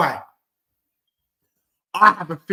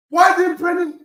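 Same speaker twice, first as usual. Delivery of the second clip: frustrated shout; 6 semitones higher than usual. Real, not acted. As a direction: bark out the frustration. Frustrated at a miss.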